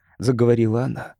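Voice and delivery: ordinary delivery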